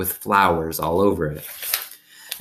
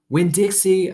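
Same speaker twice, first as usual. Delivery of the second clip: high call across the yard; calling Winn-Dixie, high and stretched; calling the dog; 13 semitones higher than usual. A narrator's reading. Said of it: "I called".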